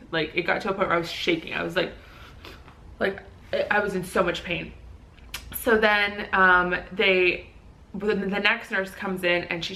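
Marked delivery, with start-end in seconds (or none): none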